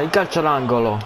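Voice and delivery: ordinary talk, nothing marked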